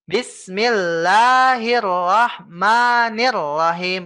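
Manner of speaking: slow chanted Bismillahirrahmanirrahim with no swing added; flowing recitation. Shown as a demonstration. Teacher showing student how to stop the swing, slow chant. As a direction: glide straight into the next letter. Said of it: "Langsung diambil huruf berikutnya".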